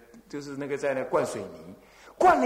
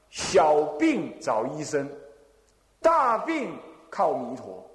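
slow quoted saying; slower than usual. Shown as a demonstration. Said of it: "祖师讲啊".

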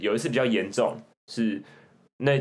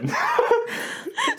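a long rising burst of laughter, very high; real laughing fit; high shrieking laugh. Real, not acted. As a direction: crack up completely. Laughs hard at kid's answer.

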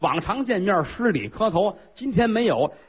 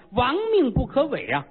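ordinary delivery

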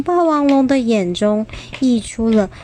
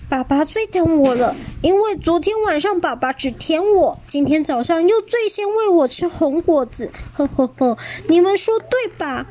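smug boast; 7 semitones higher than usual. Acted. Xiaolu boasting with a chuckle.

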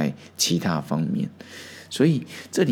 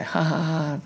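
light mock laugh, pitched higher; imitating a laugh; 5 semitones higher than usual. Zhaozhou bursts out laughing at Hanshan. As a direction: toss off a quick retold laugh. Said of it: "赵州就笑了出来".

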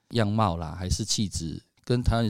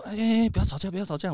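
breaking up a fight; 10 semitones higher, faster than usual. Retold or imitated. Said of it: "扯在那边啊".

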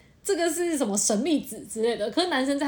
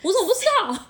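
higher, mock surprised; clueless boyfriend; 10 semitones higher, 3 dB louder, much faster than usual. Boyfriend surprised she was ever angry, mock puzzled. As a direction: play the oblivious boyfriend, puzzled. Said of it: "然后他还会说".

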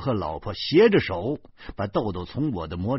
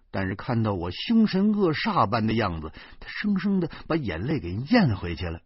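ordinary delivery

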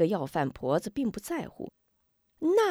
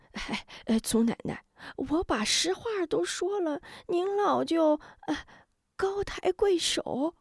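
put-on begging the old woman to spare him; desperate pleading; 10 semitones higher than usual. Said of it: "苦苦哀求".

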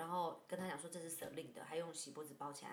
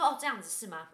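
sarcastic challenge; 3 semitones higher, 12 dB louder than usual. Retold or imitated. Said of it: "我口气是这样".

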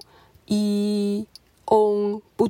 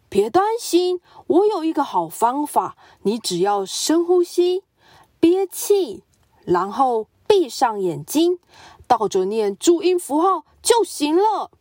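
higher, confident helpful advice; eager tiger; 4 semitones higher than usual. Acted. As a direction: sell the silly cure brightly.

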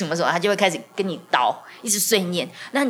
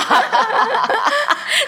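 high loud laugh; 5 dB louder than usual. Real, not acted. Laughing at own joke.

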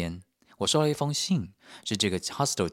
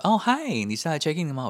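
mock cheerful receptionist; 8 semitones higher, 4 dB louder than usual. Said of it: "他就说".

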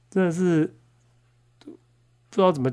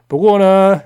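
higher, much louder host voice; 主持人腔调; show host turn; 7 semitones higher, 8 dB louder, slower than usual. Acted. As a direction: flip into the cheery announcer. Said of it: "switches to show host voice".

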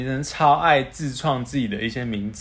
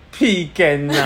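put-on southern Taiwanese accent; much slower than usual. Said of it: "然后说".

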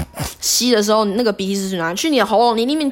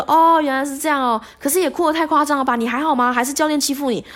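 surprised bloggers; 9 semitones higher than usual. Retold or imitated. 博主们追问.

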